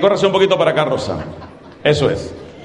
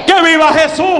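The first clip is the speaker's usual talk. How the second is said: rally cry; 9 semitones higher than usual. Real, not acted. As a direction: raise the crowd with a cheer.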